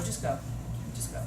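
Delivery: low-key fed-up dismissal. Shown as a demonstration. Fed-up teacher sending a kid out, weary dismissal. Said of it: "days where you're fed up".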